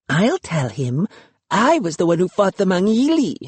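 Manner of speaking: a sister mocking her brother's boast; mimicking. A narrator's reading. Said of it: "Luki mimicked me".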